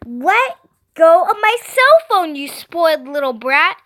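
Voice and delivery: high, loud sisterly tussle; angry yell. A narrator's reading. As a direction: yell while fighting for the phone. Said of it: "We yelled at each other".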